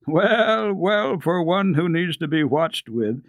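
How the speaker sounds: high, jovial teasing, slowing on well; hearty doctor. A narrator's reading. Doctor teasing his friend, high and jovial. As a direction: greet him like a laughing old friend. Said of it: "broke into a hearty laugh".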